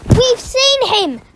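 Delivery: loud high desperate insistence. Acted. Boy to the teacher, loud desperate insistence. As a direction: insist with all he has. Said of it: "said Bertie desperately".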